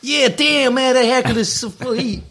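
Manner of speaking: hoch und übertrieben amerikanisch; cooler Gangster-Slang. Pretend. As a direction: wie ein lässiger Ami-Rapper. Witz über Herkules aus Yps. (amerikanischer Gangster-Ton)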